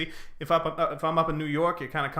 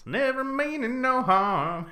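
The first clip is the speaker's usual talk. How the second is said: higher, slow mock country drawl; mock Southern drawl; 9 semitones higher, slower than usual. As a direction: drawl it out innocently like a country boy. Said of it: "good old Southern boy".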